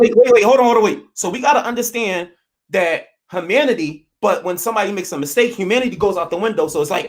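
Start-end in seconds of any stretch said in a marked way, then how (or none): 0.0-1.0 much higher and louder, cutting him off; loud interruption. Real, not acted. Cohost cutting in mid-argument, loud interruption. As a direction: shout over him to stop the argument.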